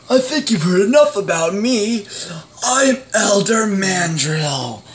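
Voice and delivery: normal talking voice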